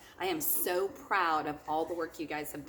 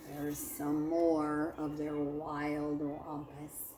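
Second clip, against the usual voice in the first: expressive picture-book read-aloud for a class; lively storybook narration; story reading to children; 2 semitones higher, 5 dB louder than usual. A narrator's reading. Teacher reads picture book aloud.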